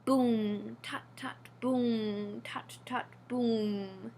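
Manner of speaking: low boom then whispered tut tut; drum rhythm. A narrator's reading. Storyteller voices the council drum, slow drum rhythm. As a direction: beat it out slowly like a drum.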